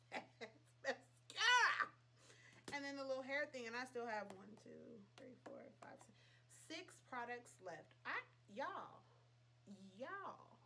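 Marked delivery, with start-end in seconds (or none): 4.4-6.2 hushed whisper, counting under her breath. Real, not acted. Counting leftover products to herself, whispered.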